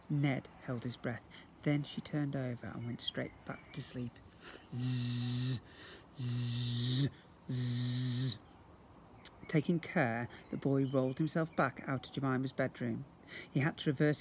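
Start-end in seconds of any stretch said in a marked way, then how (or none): 4.7-8.4 snoring. A narrator's reading. Sister sleeping, snoring sound.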